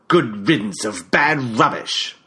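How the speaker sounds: put-on slow, scornful British accent; contemptuous British-accented send-off. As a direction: spit the insult out slowly in a British accent. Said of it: "in that British accent of his".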